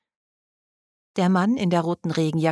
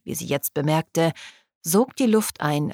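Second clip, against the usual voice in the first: plain reading voice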